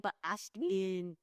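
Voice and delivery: soft, drawn-out mild reproach; imitating gentle dad. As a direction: chide very gently, stretching the name. Imitates lenient dad's mild reproach.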